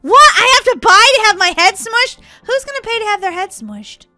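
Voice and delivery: high, loud outraged question; indignant disbelief. Real, not acted. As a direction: burst out in disbelief. Outraged at having to pay.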